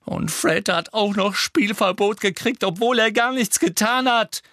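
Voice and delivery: high, loud, indignant outburst; furious. A narrator's reading. Alicia protests Fred's game ban, furious. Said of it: "sagte Alicia wütend".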